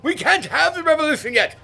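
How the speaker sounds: hammed-up high British gent; loud, high mock aristocrat; posh mock toff. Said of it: "they said".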